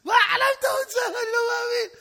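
high, loud incredulous howl; guard in hysterics at the dreamer; laughing in disbelief. Acted. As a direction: shriek it through mock laughter. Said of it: "התחיל לצחוק".

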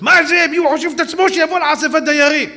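high, loud scornful yell; loud scornful neighbour; shouting neighbour. Retold or imitated. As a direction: jeer at the rabbi loudly. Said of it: "התחילה לצעוק".

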